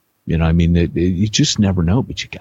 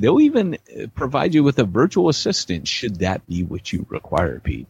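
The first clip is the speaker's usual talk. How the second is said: upbeat higher ad voice; ad read; 6 semitones higher than usual. Acted. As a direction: sell it like a radio spot. Reads ad for Simplify Church.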